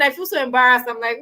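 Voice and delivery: ordinary delivery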